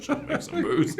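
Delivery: high laughing squeal. Real, not acted. Joking along, laughing.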